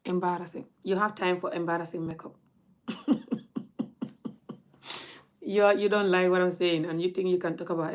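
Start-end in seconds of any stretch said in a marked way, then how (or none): 2.9-4.3 short breathy scoff of a laugh; quiet dismissive chuckle at the critic; soft scoffing laugh. Real, not acted. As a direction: laugh it off under your breath. Mocking a makeup critic.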